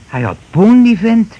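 loud, high gloat over the money; gleeful exclamation. Acted. Husband gloats to his wife over the hidden cash, loud and high. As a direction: crow with greedy delight.

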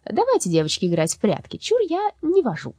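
sugary, sing-song high ending; sweet voice. Acted. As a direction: sweeten it falsely. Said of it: "сказала сладким голосом".

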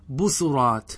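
بصرات said "busurāt", u after the s; damma on the sin. Shown as a demonstration. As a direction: say بصرات with a second u following the first. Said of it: "أعطي هذه الضمة للسين". (بصرات بضم السين)

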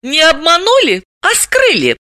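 high, precise woman's voice; pointed correction. A narrator's reading. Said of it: "уточнила Далила".

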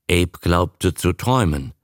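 ordinary delivery, nothing marked

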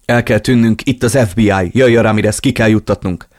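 loud, urgent hurry-up; rushing them out before the FBI; urgent call. Acted. Henchman bursts in urgently. (sürgető, hangos felszólítás)

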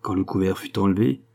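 usual speaking voice

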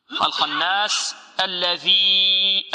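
الخناس with the doubled ن held in a nasal hum; ghunna demo. Shown as a demonstration. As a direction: hold the نّ of الخناس. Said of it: "والنون فيها غنة". (غنة النون المشددة)

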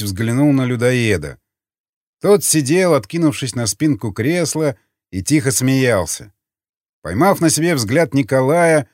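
ordinary delivery, nothing marked

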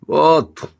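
short, high, loud cry; exclamation. A narrator's reading. Man grabs a chair to break the door, loud exclamation. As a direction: exclaim it on finding the tool. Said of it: "воскликнул он".

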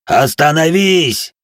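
slow, high, loud growled command; growling. Acted. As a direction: nearly growl it, furious. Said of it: "едва ли не прорычал кузнец".

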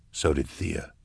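softly, almost to himself; quietly. Acted. Eisenhorn realising aloud to the room, quiet. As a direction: let it drop quietly.

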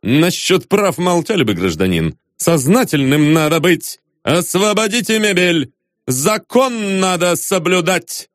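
emphatic bureaucratic rebuke; firm, hectoring commands; stern official. A narrator's reading. Acted official silencing a protester.

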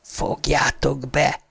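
slow, hushed order to shut up; whispered retort. A narrator's reading. Harry to the haunting voices as he hoes, whispering. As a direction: whisper it softly and slowly. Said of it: "suttogta Harry a kísértett hangoknak".

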